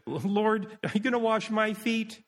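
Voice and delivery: high, acted humble question; embarrassed disbelief. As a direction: ask high-pitched, as if too unworthy for this. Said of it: "I'm so ashamed".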